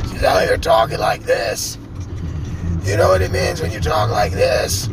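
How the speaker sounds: stuffy cold voice. Retold or imitated. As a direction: croak it like a sick DJ. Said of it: "sounds like she's got a cold".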